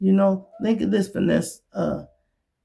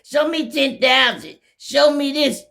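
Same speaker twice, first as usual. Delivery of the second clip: higher, louder taunting; mock teasing; 10 semitones higher, 5 dB louder than usual. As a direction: taunt them with the money. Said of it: "You be teasing everybody".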